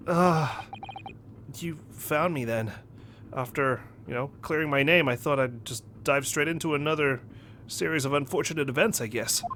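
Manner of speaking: put-on soft, hesitant young hero voice; sheepish game hero. Handcuffed hero to his captor, soft and sheepish. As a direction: mumble it like a man resigned to bad luck. Voices the hero's meek reply.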